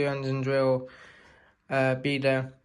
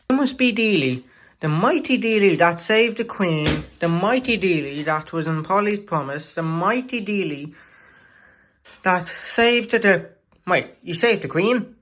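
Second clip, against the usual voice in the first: higher, awed gushing; starstruck fan; 3 semitones higher than usual. Acted. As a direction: gush like meeting a hero. Engine gushes over famous Dealey.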